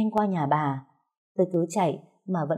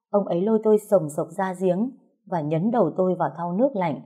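ordinary delivery, nothing marked

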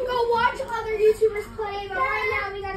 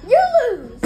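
loud gloating "you lose"; gloating shout; 4 semitones higher, 8 dB louder than usual. Acted. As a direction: taunt them loudly. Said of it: "laughed the devil".

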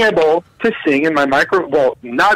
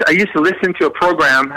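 ordinary talk, nothing marked

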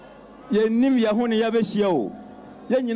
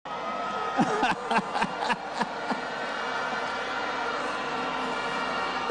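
soft, rapid belly chuckle; real laugh; 7 dB quieter than usual. Real, not acted. Speaker teasing a guest who hasn't answered, chuckling. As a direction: run of short chuckles.

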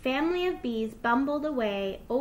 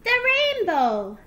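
high, loud, drawn-out cheer; gleeful little fox exclamation; laughing delight, no laugh; 12 semitones higher, 9 dB louder than usual. A narrator's reading. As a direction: crow it slowly with joy. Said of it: "laughed Little Fox".

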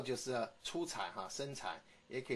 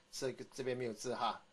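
ordinary talk

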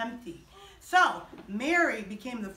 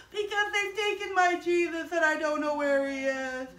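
acted crying; 2 semitones higher than usual. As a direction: whine it out as if weeping. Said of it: "why are you crying".

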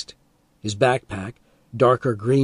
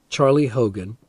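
ordinary delivery, nothing marked

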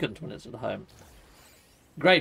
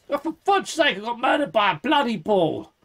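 higher, exasperated outburst; 6 semitones higher than usual. Real, not acted. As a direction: blurt it in frustration. Frustrated after dying.

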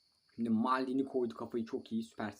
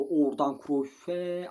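slow, louder rising call; excited call; 4 semitones higher, 8 dB louder, much slower than usual. Real, not acted. Gamer spotting the through ball, an excited drawn call. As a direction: urge the pass through.

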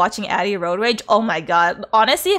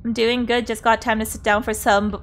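ordinary delivery

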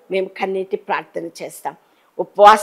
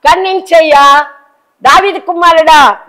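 high, loud call for mercy; desperate high shout for pity; blind man's cry; 6 semitones higher, 6 dB louder than usual. Acted. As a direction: cry out to Jesus from the roadside. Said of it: "గుడ్డివాడు అడుగుతున్నాడు".

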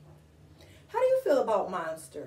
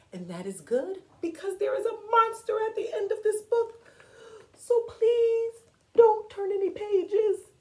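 hushed start rising to a high plea; pleading Grover; 5 semitones higher than usual. Acted. Grover begs readers not to turn pages, pleading.

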